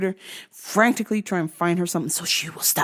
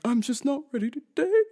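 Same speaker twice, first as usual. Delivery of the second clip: mock tearful; 4 semitones higher, 3 dB quieter than usual. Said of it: "he's just like".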